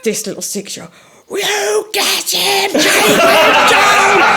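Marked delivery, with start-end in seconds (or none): 1.3-4.4 put-on high, loud, stretched child's yell; excited kid cheering. Little boy cheering the armoured fighters, in a high drawn-out yell. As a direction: shout like a small kid egging on a fighter.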